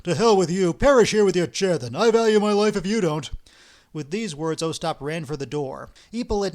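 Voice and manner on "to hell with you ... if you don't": loud, furious retort; angry outburst. Acted. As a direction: shout him off in anger. Ostap angrily abandons partner.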